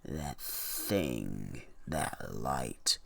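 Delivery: put-on low, slow, ominous old storekeeper; ominous shopkeeper. Shopkeeper warns the buyer about the light, low and ominous. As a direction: lean in low like you know a dark secret. Shopkeeper voiced in read story.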